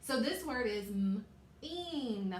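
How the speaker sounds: slow, stretched "m-een" with a long E; "m-een" sounded out long; stretched word. Shown as a demonstration. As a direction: draw out "m-een" slowly. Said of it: "long and strong E sound".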